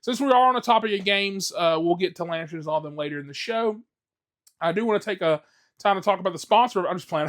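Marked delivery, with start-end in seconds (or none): none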